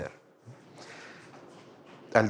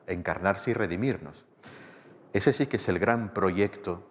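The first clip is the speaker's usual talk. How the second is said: ordinary talk, nothing marked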